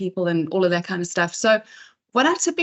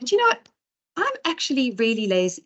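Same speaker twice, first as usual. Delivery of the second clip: imitated Irish lilt, pitched up at first; Irish accent; 4 semitones higher than usual. Speaker retelling an Irish presenter's confession, imitated Irish accent. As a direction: lightly put on an Irish accent. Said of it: "lovely Irish accent".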